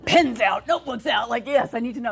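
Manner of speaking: eager laughing rush. Retold or imitated. Eager note-taking with daughter, laughing.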